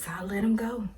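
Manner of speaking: soft breathy whisper; whispered giving-in. Real, not acted. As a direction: let it go in a hush. Giving up the strawberries.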